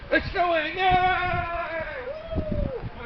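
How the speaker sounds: high, joyful cheer; excited cheer. Real, not acted. Cheering at the snow.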